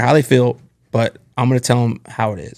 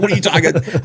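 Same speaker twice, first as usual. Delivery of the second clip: higher-pitched, mad protest; retold indignation; 6 semitones higher than usual. Young manager objects to boss's criticism, higher indignant retelling. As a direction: protest in a raised pitch, re-enacting your anger. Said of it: "I'm mad".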